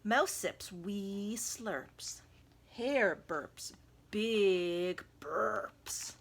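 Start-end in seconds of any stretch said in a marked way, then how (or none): 2.7-6.1 very slow, louder drawn-out words; slow burpy reading. A narrator's reading. Narrator drawing out the hare's burps slowly. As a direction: stretch it like a big burp.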